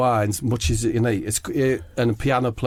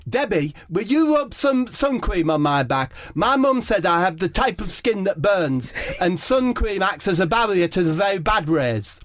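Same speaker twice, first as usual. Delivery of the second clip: high, prim Harry Kane voice; Harry Kane asking his teammate for sun cream, high and prim; Harry Kane impression; 8 semitones higher than usual. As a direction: ask it fussily like a polite boy. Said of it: "Harry takes his shirt off".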